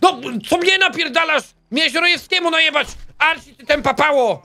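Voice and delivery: high, indignant scolding; mock angry yell. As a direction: chew him out like a furious boss. Yelling at a failed inmate.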